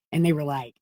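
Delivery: ordinary talk, nothing marked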